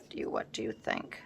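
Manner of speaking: soft whisper, question trailing away under her breath. Real, not acted. Crafter musing over her layout, whispering the question. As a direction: drop to a whisper and ask yourself.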